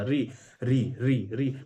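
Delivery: री repeated as a clean single syllable; sounding out री. Shown as a demonstration. Teacher to class, demonstrating री syllable by syllable. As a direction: say री, री so students hear it alone. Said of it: "री बोलिए और कुछ नहीं आता".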